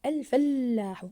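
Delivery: "الفلاح" slow and loud, long doubled ل; stressed doubled letter. Shown as a demonstration. Teacher confirming the doubled lam, stressed doubled letter. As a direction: hold the middle ل in "الفلاح" firmly. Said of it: "ضاعفنا حرف اللام في كلمة".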